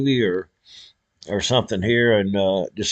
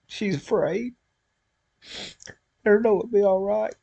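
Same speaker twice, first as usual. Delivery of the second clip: real crying, high and much softer; voice breaking; 9 semitones higher, 7 dB quieter than usual. Real, not acted. Praying for his scared daughter.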